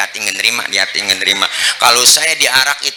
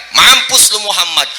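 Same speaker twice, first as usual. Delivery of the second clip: higher, louder mock greeting; sneering curse; 8 semitones higher, 3 dB louder than usual. Shown as a demonstration. Unbeliever curses the Prophet, higher and louder. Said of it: "Kalau kita dikata begitu".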